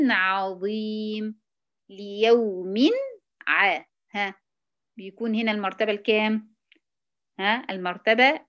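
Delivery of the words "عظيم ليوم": عظيم drawn out slowly; slow tajweed reading; recitation demo. Shown as a demonstration. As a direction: recite ليوم عظيم with length. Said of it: "كلمة زي كلمة ليوم".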